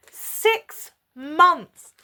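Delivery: loud, high, each word apart; outraged repeat. Real, not acted. Woman repeats his answer to her viewers, loud and outraged. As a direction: punch both words out in disbelief.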